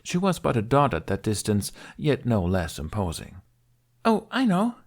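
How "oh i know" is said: put-on high, eager girl's chatter; bubbly and high; bright. A narrator's reading. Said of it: "Kamikaze expressed to Hiccup brightly".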